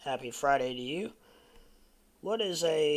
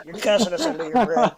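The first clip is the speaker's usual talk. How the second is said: laughing; 5 semitones higher, 8 dB louder than usual. Real, not acted. Repeats the joke, still laughing.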